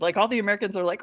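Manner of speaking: ordinary talk, nothing marked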